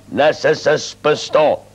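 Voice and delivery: slow mock solemn. Mock mourning greeting.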